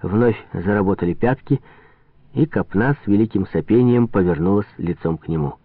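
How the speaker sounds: plain reading voice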